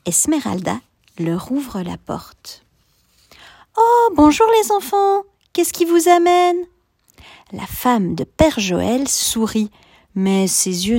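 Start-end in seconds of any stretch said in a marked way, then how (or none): 3.8-6.6 put-on high, louder kindly older woman; Esmeralda's voice. A narrator's reading. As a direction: greet the children as the tired wife.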